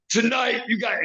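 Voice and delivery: high, shouted wrestling promo opener; wrestler promo mode. Retold or imitated. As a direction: bark it like a heel manager on the mic. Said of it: "I just went into wrestler mode".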